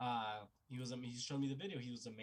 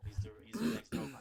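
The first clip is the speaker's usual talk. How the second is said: excited hype; 9 semitones higher, 5 dB louder than usual. Retold or imitated. Friend excitedly hyping young player.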